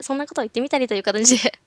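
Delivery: normal talking voice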